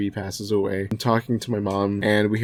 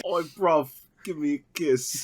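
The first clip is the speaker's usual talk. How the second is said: mock British accent; 5 semitones higher than usual.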